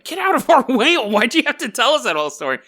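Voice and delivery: mock outraged officers; exasperated cops. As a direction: snap at him to move aside. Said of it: "The cops are like".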